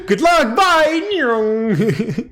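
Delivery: high, loud chirpy farewell; bright sing-song send-off; cheery goodbye. Acted. Character waves goodbye cheerfully.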